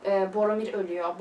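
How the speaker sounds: ordinary delivery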